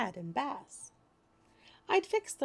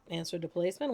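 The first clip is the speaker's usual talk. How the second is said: ordinary delivery, nothing marked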